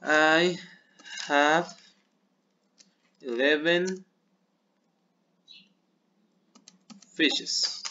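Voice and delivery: each word set apart by long pauses; slow dictation. Shown as a demonstration. Teacher dictating an English sentence to pupils. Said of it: "¿cómo se diría esto?".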